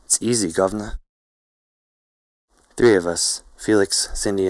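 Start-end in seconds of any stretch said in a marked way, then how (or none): 0.0-1.0 playful Cockney British voice; Cockney accent. A narrator's reading. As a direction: put on Cockney to show how easy it is. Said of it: "she demonstrates in Cockney British".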